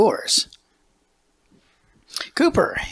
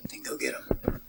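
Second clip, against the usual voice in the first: hushed whisper of encouragement; 14 dB quieter than usual. Real, not acted. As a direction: breathe it softly to the player. Host whispers to player.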